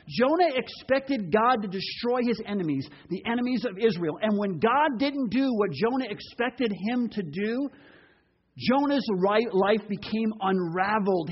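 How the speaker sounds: ordinary talk, nothing marked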